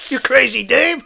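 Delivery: loud, high-pitched yell; hammed-up outrage; angry insult. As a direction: hurl the insult at them. Detective insults witness in rage.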